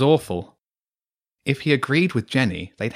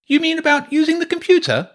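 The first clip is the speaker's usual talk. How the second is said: booming Hugo; 6 dB louder than usual. Acted. Hugo butting into the friends' talk, booming. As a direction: boom it out like a loud know-it-all kid. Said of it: "Hugo's voice boomed across the table".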